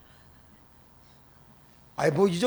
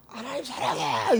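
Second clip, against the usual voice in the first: retold car prayer; 4 semitones higher, 3 dB quieter, slower than usual. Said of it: "이거를 삼십 분을 했어요".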